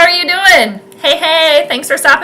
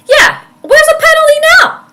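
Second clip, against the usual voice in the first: much higher sarcastic protest; mock the refs for no call; outraged squeal; indignant; 4 dB louder than usual. Real, not acted. Sarcastic complaint about the refs.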